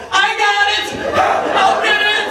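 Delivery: high, loud imitation of a rushing helper; mock eager shout. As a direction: call out in comic eagerness. Stranger sprinting onto set calling out.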